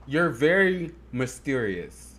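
usual speaking voice

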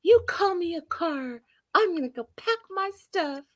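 high, wronged mock indignation; Debbie impression. Acting out a woman leaving him, high indignant voice. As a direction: order it in a high, hurt voice. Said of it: "she's like".

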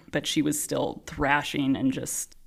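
normal talking voice

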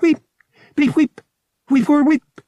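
high, loud electronic bleeping; distressed droid bleeps. A narrator's reading. Droid announces in evident surprise, distressed bleeping. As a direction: imitate an agitated little droid's bleeps. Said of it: "distressed bleeps, blurps, and wheeps".

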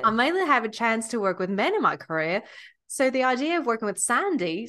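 attempted Australian accent; Nicole Kidman. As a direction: imitate an Australian actress. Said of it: "You do her accent really well".

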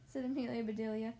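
usual speaking voice

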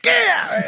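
very high, hammed-up yelp; mock pained yell. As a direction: squeal as the elbow lands. Guy hit by elbow.

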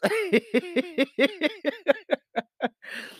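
very high squealing laugh, real; high laughter. Real, not acted. Laughing again at her own shambles. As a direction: let the laugh run out.

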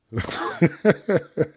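a burst of high laughter; amused high laugh. Real, not acted. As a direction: laugh at your own joke. Laughing at own word choice.

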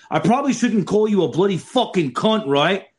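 squeaky high imitation of the Australian sergeant; high mock Aussie. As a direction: pitch way up, mate-style bluntness. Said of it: "And he goes".

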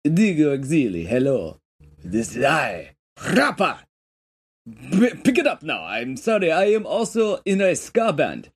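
put-on South African accent. Poisoner introducing himself in a slipping South African accent. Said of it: "South African kind of accent".

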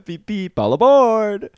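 high conductor call all aboard; train conductor call. Pretend.

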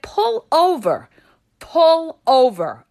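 loud, urgent demand; urgent command. Retold or imitated. Woman telling her date to pull over, urgent and loud. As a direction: order him to stop the car. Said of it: "I said".

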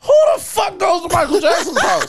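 loud, high-pitched comic disbelief; incredulous outburst. Real, not acted. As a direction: shout the question in amused astonishment. Astonished joke about dead star's house.

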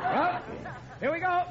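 chirpy launch into driving; eager, high here we go. Acted. Repeated start, higher each time.

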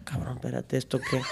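an under-the-breath grumble; mock dad aside.

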